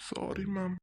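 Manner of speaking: soft, voiceless whisper; whispered apology. Acted. Student apologises to the teacher, barely whispered.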